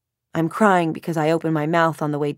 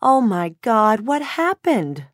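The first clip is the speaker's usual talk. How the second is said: high, drawn-out shocked cry; slow, horrified gasp of a worried mother; alarmed mother; 5 semitones higher, 5 dB louder, slower than usual. A narrator's reading. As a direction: burst in, aghast. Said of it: "says my mother's voice".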